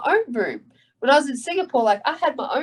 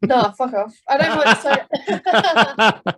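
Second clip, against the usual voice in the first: louder, higher, breaking into drawn-out laughter; laughing refusal; 4 semitones higher, 4 dB louder, much slower than usual. Real, not acted. Fighter asked for an American accent, laughing refusal. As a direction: refuse through a big laugh.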